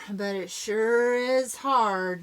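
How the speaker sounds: plain reading voice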